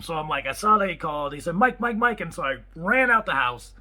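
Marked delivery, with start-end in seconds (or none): 1.5-2.2 quicker, pressing, raised call of the name; urgent calling. Retold or imitated. Owner calling Mike about trouble: raised urgent repeat. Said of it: "he calls, there's a problem".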